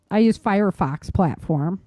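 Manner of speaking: ordinary talk, nothing marked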